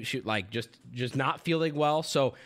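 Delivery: ordinary delivery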